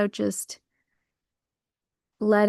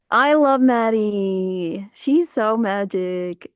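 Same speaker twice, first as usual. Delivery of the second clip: very slow, stretched, louder gush; drawn-out gush; 4 dB louder, much slower than usual. Real, not acted. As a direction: stretch each word with love. Gushing about a friend.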